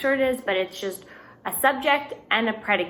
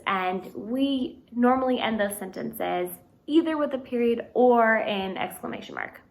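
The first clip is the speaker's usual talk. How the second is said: ordinary talk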